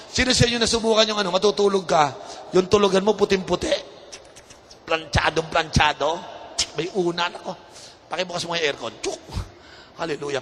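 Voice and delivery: usual speaking voice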